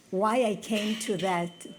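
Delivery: ordinary delivery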